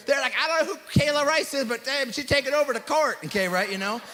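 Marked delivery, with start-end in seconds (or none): 0.4-3.2 imitated courtside commentator, louder and pushed; excited sports announcer. Opposing team's announcer amazed by the player, excited. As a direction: call it like a thrilled announcer. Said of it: "the announcers were going crazy".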